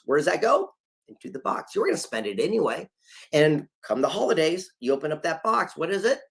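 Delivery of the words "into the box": secretive whisper punchline. Shown as a demonstration. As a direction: whisper into the box. Same punchline repeated.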